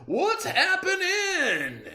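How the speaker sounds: booming high cheery shout; loud hearty greeting. A narrator's reading. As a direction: bellow it like a back-slapping pal. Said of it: "a loud".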